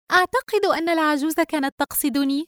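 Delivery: high, repelled daughter voice; disgusted. A narrator's reading. Daughter telling mother the old woman meant her, disgusted. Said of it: "رجاء باشمئزاز".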